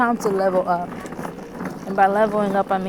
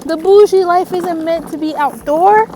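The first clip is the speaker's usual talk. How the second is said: high, loud diva voice; sassy bougie voice; 9 semitones higher, 7 dB louder than usual. Acted. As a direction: play the spoiled city girl. Bougie persona continues, sassy.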